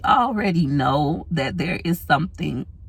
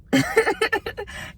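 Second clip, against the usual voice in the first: high laugh. Real, not acted. Caught lying, laughs.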